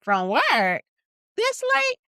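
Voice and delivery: high, loud disbelieving questions; incredulous grandmother. Retold or imitated. Grandmother hearing of late work, imitated high incredulity. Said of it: "my Grammy called me".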